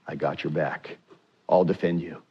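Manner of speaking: a quiet promise of protection; gentle voice of God, low and hushed; reassuring God. Retold or imitated. God answering Moses.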